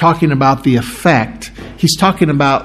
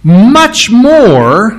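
building excitement; 3 semitones higher, 4 dB louder, much slower than usual. Acted. Preacher reading Paul to the church, growing excited. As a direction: let the verse swell up into much more. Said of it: "this is where Paul starts getting excited".

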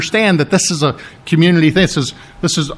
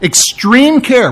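"Extreme care" slow, with Extreme pitched high and leaned on; stressed slowly; 6 semitones higher, 3 dB louder, slower than usual. Shown as a demonstration. Preacher explaining the Greek word to his church, slow stress on Extreme. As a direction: draw out "Extreme care", hitting Extreme hard. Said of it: "it means very much excessively".

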